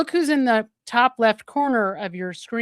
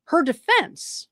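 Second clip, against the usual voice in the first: usual speaking voice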